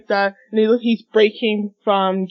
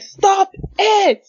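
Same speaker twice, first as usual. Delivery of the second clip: high, stretched-out shout; dramatic cry; 13 semitones higher than usual. Acted. Reader voicing Shoto crying out to stop, dramatic cry. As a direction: cry it out as a desperate plea, acted.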